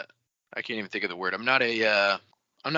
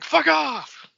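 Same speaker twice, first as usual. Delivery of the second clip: very high, snippy brush-off; petty mock dismissal; 4 dB louder than usual. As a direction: throw a petty insult at the guards.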